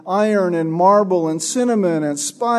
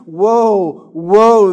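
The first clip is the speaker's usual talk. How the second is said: crying "Woe, woe" louder and louder, full of hopelessness; anguished lament; 3 semitones higher, 6 dB louder than usual. Acted. Kings of the earth mourning Babylon, a rising anguished wail. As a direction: wail "Woe, woe" like a king watching his city fall. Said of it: "the utter loss and hopelessness in their voice".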